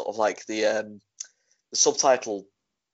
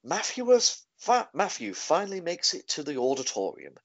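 voiceover announcer; 5 semitones higher than usual. Retold or imitated. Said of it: "the voiceover guy just makes a comment".

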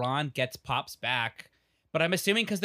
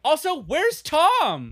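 excited question; 11 semitones higher, 5 dB louder, much slower than usual. Real, not acted.

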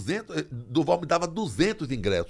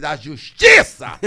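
slow, much louder proclamation; booming announcement; 13 dB louder, much slower than usual. Retold or imitated. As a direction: boom it so it echoes. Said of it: "A voz chegou a dar eco". (anúncio lento e muito alto, com eco)

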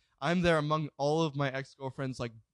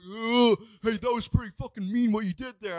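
higher-pitched mock complaining voice; slumpy brothers impression; 6 semitones higher than usual. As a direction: imitate a slouchy guy's grievance. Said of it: "they're both pretty slumpy".

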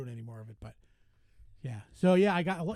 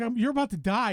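mock threat; 6 semitones higher, 5 dB louder than usual. Retold threat on the show.

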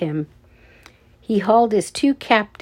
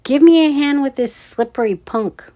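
raised, louder call for help; 6 semitones higher, 5 dB louder than usual. A narrator's reading. Guard struggles with a slippery kid, calling out. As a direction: call over for a hand, irritated. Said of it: "the red-headed guard called".

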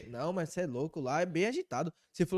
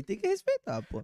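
hyped mock voice; 13 semitones higher than usual. Hyping the important tattoo.

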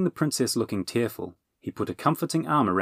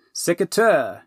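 commanding spell word; 9 semitones higher, 5 dB louder, slower than usual. Acted. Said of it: "he ordered".

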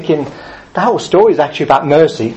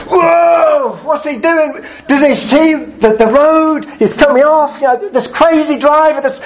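much higher, shouted mock road rage; 10 semitones higher, slower than usual. Preacher acting out a driver cut off at the roundabout, mock shouting. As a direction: yell at the driver who cut you off, hammed up. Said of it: "occasional moments of anger".

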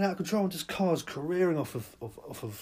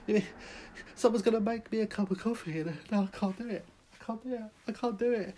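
high, strained can't-do-it panic; panicked whine; 9 semitones higher than usual. Acted. Diner panicking at being served coffee, high whine. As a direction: freak out over a cup of coffee.

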